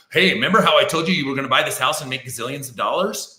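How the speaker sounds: mock hype. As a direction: parody an overpromising agent, fast and punchy. Mocks an overpromising agent's pitch.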